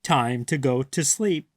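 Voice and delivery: usual speaking voice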